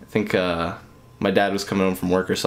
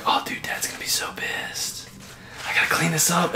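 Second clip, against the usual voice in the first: put-on whispered childhood panic; panicked kid whisper; 6 semitones higher, 7 dB quieter than usual. Host as a five-year-old after spilling Coke, whispering in panic. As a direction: hiss it like a scared kid hiding a mess. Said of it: "being like".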